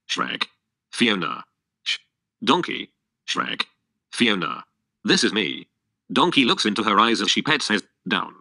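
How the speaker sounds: plain reading voice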